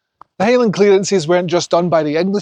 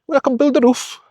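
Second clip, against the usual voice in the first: imitated English accent, pitched much higher; 10 semitones higher than usual. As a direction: mimic an Englishman making an offer in a high voice. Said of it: "doing the way he actually spoke".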